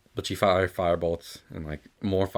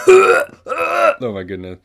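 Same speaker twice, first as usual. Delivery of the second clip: loud, slow, higher oh my goodness; cringing exclamation; 9 semitones higher, 13 dB louder, much slower than usual. Real, not acted. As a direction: exclaim it in embarrassment, drawn out. Cringing reaction.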